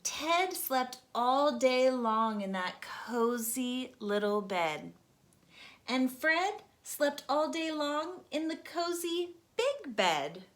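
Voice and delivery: usual speaking voice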